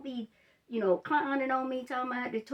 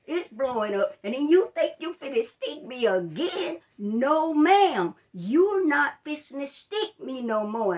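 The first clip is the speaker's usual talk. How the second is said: loud, higher retold yelling at the nurse; angry yelling; 5 semitones higher, 8 dB louder than usual. Swollen-hand patient yelling at the nurse, angry loud rant. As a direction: let it all out, you've had enough. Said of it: "I'm about to lose it in that room".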